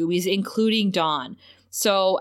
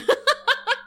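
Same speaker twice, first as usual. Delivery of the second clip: high laugh. Real, not acted. Laughing at Jinx's line.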